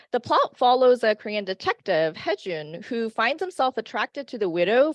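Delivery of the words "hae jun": ordinary talk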